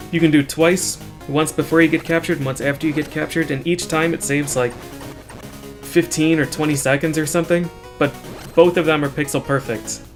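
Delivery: normal talking voice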